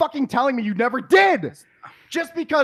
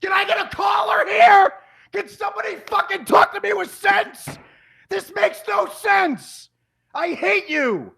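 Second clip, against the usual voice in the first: furious shout; 8 semitones higher than usual. Real, not acted. Boyfriend yelling at partner for nonsense, furious shout. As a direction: yell it to the heavens, out of patience. Furious argument over asking time.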